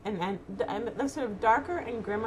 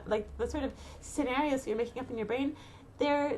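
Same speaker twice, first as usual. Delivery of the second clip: usual speaking voice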